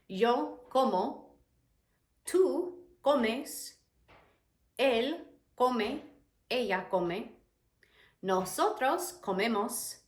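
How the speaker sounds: slow, spaced-out Spanish verb forms; measured recital with pauses between forms; conjugation drill. Shown as a demonstration. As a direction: recite each form separately for learners. Recites conjugation table for learners.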